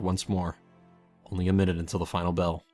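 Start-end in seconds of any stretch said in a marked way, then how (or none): none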